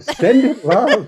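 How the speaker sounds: a giggly second blessing; laughing catchphrase. Shown as a demonstration. To his co-host, giggling his signature send-off line. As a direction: repeat it with a laugh breaking in. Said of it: "I wouldn't be me without saying".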